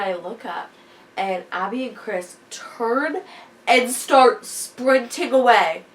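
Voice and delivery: ordinary talk, nothing marked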